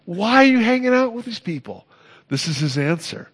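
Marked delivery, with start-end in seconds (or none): 0.0-1.9 high, scandalized questioning of Jesus; indignant Pharisee. Retold or imitated. Pharisee challenging Jesus about his company, indignant and high. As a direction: voice the critics' shocked complaint, pitched up. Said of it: "he said".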